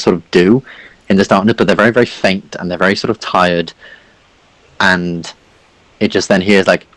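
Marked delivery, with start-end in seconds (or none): none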